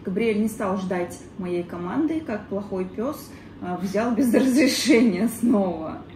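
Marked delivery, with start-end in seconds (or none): none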